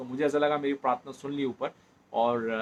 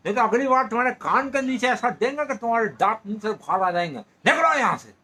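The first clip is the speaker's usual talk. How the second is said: angry shouting father; 7 semitones higher, 7 dB louder than usual. Retold or imitated. Woken father yells at the boy knocking at dawn, furious. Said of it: "बड़े गुस्से से निकले और बोले".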